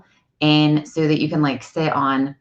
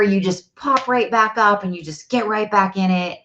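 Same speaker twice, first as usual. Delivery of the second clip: put-on higher-pitched, encouraging kid-talk; animated pep talk; 5 semitones higher than usual. Mother encouraging son about soccer, animated pep talk. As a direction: cheer him on in a raised, lively voice.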